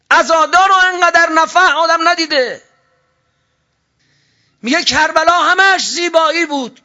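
high, loud, impassioned preaching; preacher shouting at full force from the pulpit; fiery preaching. Acted. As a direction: thunder it out to the congregation.

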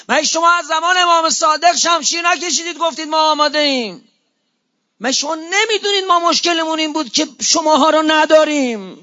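high, loud reproachful cry; impassioned rebuke. Acted. The Imam reproaches his followers, loud, high and impassioned. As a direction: plead and scold at full force.

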